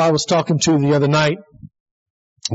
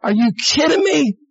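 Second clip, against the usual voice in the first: retold high shout of disbelief; incredulous outburst; 7 semitones higher than usual. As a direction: act out a stunned friend shouting at him. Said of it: "I just went".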